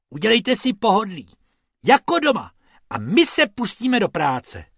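high, lively announcement; announcing. A narrator's reading. Rabbit inviting tourists to make themselves at home, announcing in a high voice. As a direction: announce it brightly as a story character. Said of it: "oznámil Bob".

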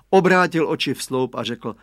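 ordinary delivery

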